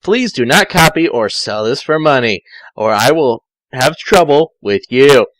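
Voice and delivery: ordinary delivery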